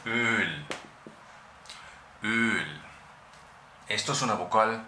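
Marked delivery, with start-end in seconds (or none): 0.0-2.8 Öl with a long front rounded Ö; German word Öl. Shown as a demonstration. Teacher pronouncing the German word Öl. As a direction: stretch the Ö in Öl. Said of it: "la palabra de aceite en alemán".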